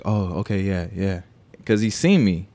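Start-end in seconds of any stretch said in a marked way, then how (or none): 0.0-1.2 retold backing down, a little softer; chilled out. Man backs down once the brother is seen, chilled out. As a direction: imitate someone suddenly agreeable. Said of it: "he chills out".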